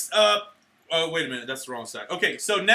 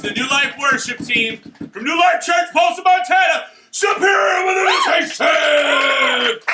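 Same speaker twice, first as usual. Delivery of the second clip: big announcer reveal; 8 semitones higher, 8 dB louder than usual. Pretend. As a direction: build higher and louder to the grand reveal. Climax announcement after drum roll.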